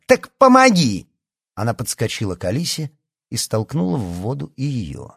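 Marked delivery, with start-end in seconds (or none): 0.1-1.1 very high, loud retort; explosive, pitched-up order; furious shout. A narrator's reading. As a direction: yell it as a spiteful command. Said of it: "крикнула Лия".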